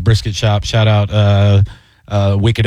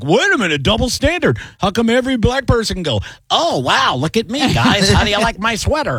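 lightly higher aggrieved voice; 8 semitones higher than usual. Pretend. Imagining someone pushing double standards, mock indignant voice.

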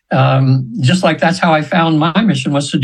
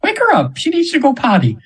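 much higher, imitating the client's gentle angel voice; angel's voice; 11 semitones higher than usual. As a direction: raise the voice high to mimic the angel speaking through her. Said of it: "I'm almost using her voice now".

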